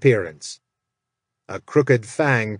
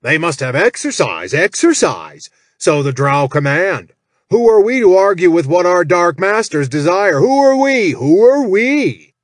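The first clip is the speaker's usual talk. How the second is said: much higher, louder, mock-pious tirade; mocking lecture; 5 semitones higher, 6 dB louder than usual. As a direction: sneer the rhetorical questions. Jack as Malmore lectures gnoll.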